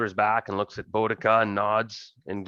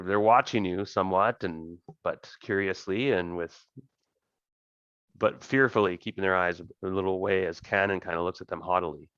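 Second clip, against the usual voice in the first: normal talking voice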